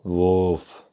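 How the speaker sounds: single loud dog woof; loud dangerous bark. A narrator's reading. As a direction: bark to scare off a guard. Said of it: "einmal laut und gefährlich". (lautes, gefährliches Bellen)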